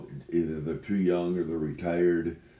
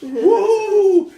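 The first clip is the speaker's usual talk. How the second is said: gleeful whoop; 15 dB louder than usual. Acted. Whoops over day off.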